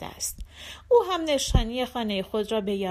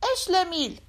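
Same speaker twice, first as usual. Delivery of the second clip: called out; 8 semitones higher than usual. Acted. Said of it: "داد زد".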